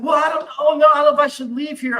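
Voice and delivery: put-on high, loud panicky girl, words tumbling fast; imitating panicked girl. Teller mimics the scared girl refusing to leave, high panicky. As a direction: mimic her frantic worry about leaving. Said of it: "she's freaking out".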